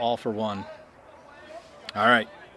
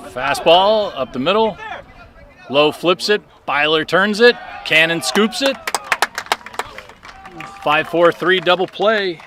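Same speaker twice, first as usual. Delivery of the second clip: loud, high baseball announcer call; excited play-by-play; 6 semitones higher, 6 dB louder than usual. Real, not acted. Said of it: "I sound like a baseball announcer".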